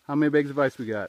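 fast, loud call. Real, not acted. Asking Dad about the ice, fast and loud. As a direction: shout it quickly across the yard.

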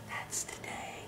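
hushed, voiceless whisper; very soft confiding whisper; whispered aside. Real, not acted. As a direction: whisper it like a little secret.

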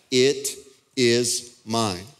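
IT IS MINE, each word separated and firm; emphatic. Retold or imitated. Preacher reading God's claim to Israel, emphatic. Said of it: "this is emphatic".

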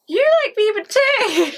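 high, loud teasing gotcha; teasing excitement. Real, not acted. Girlfriend catches boyfriend out liking [name], high delighted tease.

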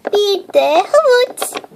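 ordinary talk, nothing marked

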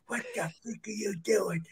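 hushed, outraged hiss, acted out; furious whisper. Headliner confronting the young comic, furious whisper. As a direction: whisper it like a scandalised performer.

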